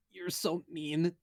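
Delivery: high, slow pouty whine; whiny Eris. Acted. Eris voiced.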